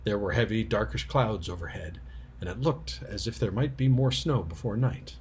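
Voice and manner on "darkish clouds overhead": ordinary delivery, nothing marked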